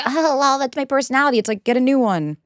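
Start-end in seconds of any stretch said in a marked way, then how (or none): 0.0-1.4 higher-pitched put-on shrug in the voice; mock dismissive excuse. Passive-aggressive partner excusing themselves, mock dismissive. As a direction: mimic someone brushing off criticism.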